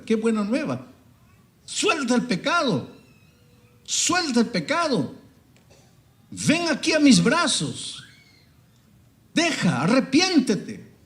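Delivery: high, loud, impassioned preaching, slowing on the pleas; fiery preaching. Acted. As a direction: preach it out at full force, pleading. Preaching at full force. (predicación apasionada, aguda y fuerte)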